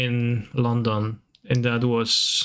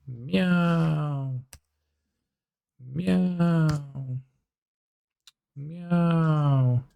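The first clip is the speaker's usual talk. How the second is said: drawn-out higher meows, each falling; mock cat meows; 3 semitones higher, much slower than usual. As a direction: meow like a cartoon cat.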